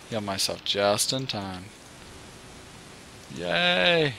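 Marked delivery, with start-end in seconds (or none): 3.3-4.1 high happy yay; cheering. Real, not acted. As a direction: cheer like you made it. Survived the fall.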